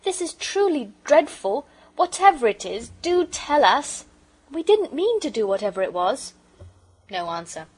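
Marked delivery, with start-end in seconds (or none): 0.0-6.3 put-on high, loud pleading child; despairing. A narrator's reading. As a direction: implore them in acted despair. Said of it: "said Phyllis in despair".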